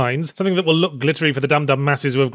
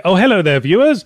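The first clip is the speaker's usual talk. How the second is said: mock surprised greeting; 3 semitones higher, 6 dB louder than usual. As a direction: act suddenly caught out, greet them loudly. Pretends to suddenly notice the audience.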